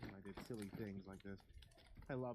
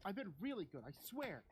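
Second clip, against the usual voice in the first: put-on pleading child; 10 semitones higher than usual.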